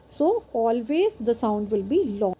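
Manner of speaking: ordinary talk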